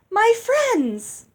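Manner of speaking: high, loud ringing address; ringing call. A narrator's reading. Lucinda addressing the wedding guests, ringing out high and loud. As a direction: call the whole hall to attention. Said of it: "she rang out".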